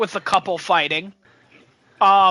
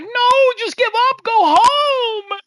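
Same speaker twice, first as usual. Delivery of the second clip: pleading; 5 dB louder, slower than usual. Retold or imitated. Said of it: "where I was like".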